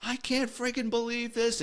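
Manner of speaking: high-pitched mock scream without the volume. Van owner finding his stripped van, high mock scream. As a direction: imitate a man screaming in disbelief, held high. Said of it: "he just starts screaming".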